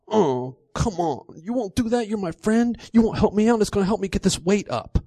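high, whiny pout. A narrator's reading. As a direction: plead like a sulking child. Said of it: "He pouts".

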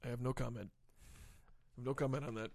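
very quiet, low voice; hushed no comment. Acted. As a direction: drop to a low, guarded near-murmur. Evasive answer to a leading question.

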